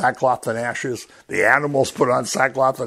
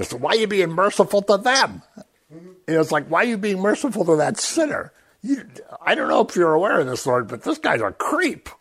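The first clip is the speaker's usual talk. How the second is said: raised, pleading complaint to God; whiny Jonah; 7 semitones higher than usual. Retold or imitated. Jonah whining to God about mercy for sinners, pleading higher voice. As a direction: whine at God like a sulking prophet.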